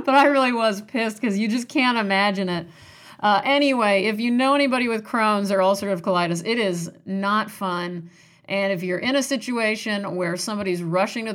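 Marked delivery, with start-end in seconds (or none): none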